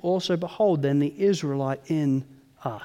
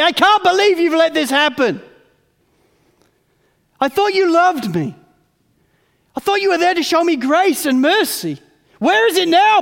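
much higher and louder, hurt and accusing; bitter angry complaint; 8 semitones higher, 8 dB louder than usual. Acted. A wounded believer accusing God, bitter and loud. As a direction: demand answers from heaven.

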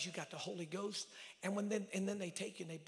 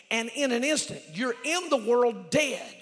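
climbing to a high sustained shout; full-force preaching; 10 semitones higher, 28 dB louder, slower than usual. Real, not acted. Preacher telling congregation they are members, building to shouting. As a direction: drive each phrase home at full volume.

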